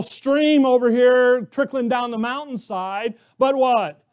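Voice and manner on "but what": normal talking voice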